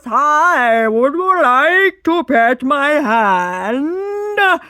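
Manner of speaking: overbearing mock-friendly shout; intimidating greeting. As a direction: loom over them with a huge, slow hello. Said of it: "it's really intimidating".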